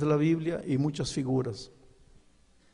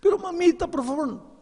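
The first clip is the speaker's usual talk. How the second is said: put-on pleading boy; 4 dB louder than usual. Son begs mother to spare him.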